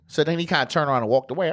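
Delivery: ordinary delivery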